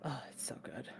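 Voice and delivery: breathless awed whisper. Real, not acted. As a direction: whisper it in awe. Awed reaction to anime artwork.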